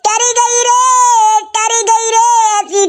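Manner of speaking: slow, drawn-out mock lament for a dead mother; mock wailing. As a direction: wail it like a hammed-up mourner. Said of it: "तू रो रहा है?".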